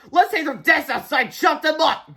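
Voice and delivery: high, loud cheering; rally everyone with mean glee; gleeful cry. A narrator's reading. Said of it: "cried Miss Ripple".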